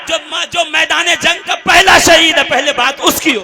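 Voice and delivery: loud, insistent sermon declamation; full-force preaching. Acted. Preacher insists the first martyr comes first, loud oratory. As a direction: drive the point out to the crowd.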